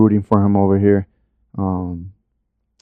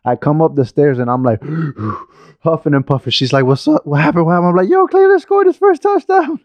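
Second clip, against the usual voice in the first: higher-pitched, excited storytelling; excited retelling; 5 semitones higher than usual. Retold or imitated. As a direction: tell it like you ran upstairs with big news. Excited retelling of watching the game.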